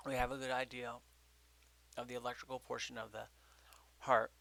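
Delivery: talking while chewing gum. Real, not acted. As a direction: speak around a piece of gum. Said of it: "Sorry, I'm chewing a piece of gum".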